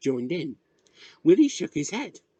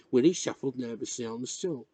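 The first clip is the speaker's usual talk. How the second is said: usual speaking voice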